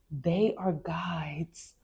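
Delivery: slow whispered reading. Real, not acted. Reading the book to viewers, whispered slowly. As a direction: breathe the words out, slowly.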